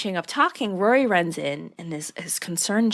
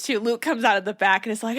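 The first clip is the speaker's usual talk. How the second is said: ordinary delivery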